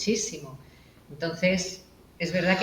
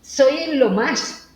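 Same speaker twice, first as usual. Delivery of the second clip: put-on proud kid; 7 semitones higher, 5 dB louder, slower than usual. Voicing the proud third-place kid.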